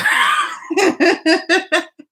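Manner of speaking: loud, high burst of laughter; shouting laugh. Real, not acted. Laughing big after describing what real anger is. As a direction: break out laughing loudly at your own image.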